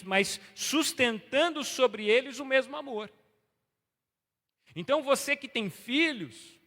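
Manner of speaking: loud, high fervent preaching. Acted. Pastor preaching loudly to the congregation, fervent. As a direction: preach it out to the church.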